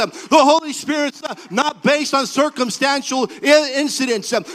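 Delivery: loud, rising preacher's cry over music; preaching at full force. Acted. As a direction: build the sermon to a fervent climax.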